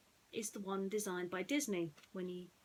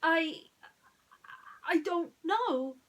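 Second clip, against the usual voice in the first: halting, higher and slow; hesitant; 7 semitones higher, 5 dB louder, slower than usual. A narrator's reading. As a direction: stumble unsure, a bit scared. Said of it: "said hesitantly".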